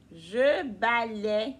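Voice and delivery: P=A; slow repeat. Shown as a demonstration. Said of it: "Qui dit je balaie?".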